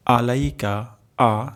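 আ voiced from the middle of the throat and lengthened. Shown as a demonstration. Teacher shows the throat sound of আ to students. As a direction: stretch the final আ from mid-throat. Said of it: "আ উচ্চারণ হবে গলার মাঝখান থেকে".

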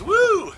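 high, loud "woo"; excited whoop. Real, not acted. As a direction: let out a quick high whoop. Exclaims while handling the pizza.

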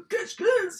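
high, loud startled call; startled shout. Real, not acted. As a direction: blurt the name out in shock. Startled reading of game name.

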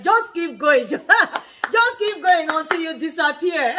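high, laughing explanation; amused, laughing through the words. Real, not acted. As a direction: crack up while explaining. Cracks up explaining the phrase.

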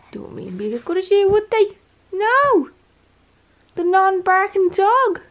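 very high, loud, doting dog voice; cooing pet talk. Real, not acted. Owner praises the non-barking dog, cooing. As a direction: squeal it affectionately to your dog.

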